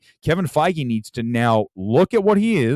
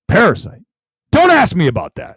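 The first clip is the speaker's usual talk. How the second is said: louder, higher, irritated Tarantino; pissed-off snap; 5 semitones higher, 7 dB louder than usual. Retold or imitated. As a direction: shut the question down hard. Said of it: "he's pissed".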